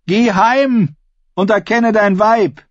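high, ringing comeback; projected, high-pitched put-down; bold retort. A narrator's reading. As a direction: fire back at the heckler. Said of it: "entgegnete Ascher".

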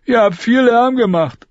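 put-on a scared boy reporting; Lommi. Lommi talking to soldiers.

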